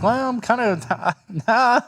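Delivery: high, slow mock waffling, then a laugh; wavering Democrat. Imagining Democrats backing out, mock wavering. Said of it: "the Democrats would be like".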